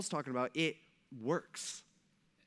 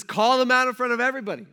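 higher and louder, a preacher's call to action; forceful command; 6 semitones higher, 9 dB louder, faster than usual. Retold or imitated. Pastor voicing Paul to his congregation, forceful loud command. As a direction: belt it out like a commanding order. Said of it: "He says".

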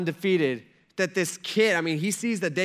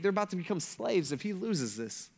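normal talking voice

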